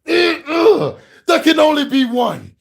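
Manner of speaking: high, loud hammed-up warrior shout; over-the-top movie warrior yell; mock Highlander battle cry. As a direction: bellow it like a sword fighter in the rain. Acting Highlander fight in rain.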